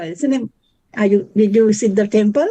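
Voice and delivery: ordinary delivery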